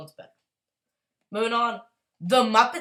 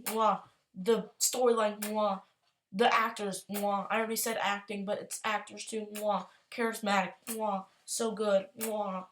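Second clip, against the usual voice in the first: chef's kiss; 4 dB quieter than usual. Real, not acted.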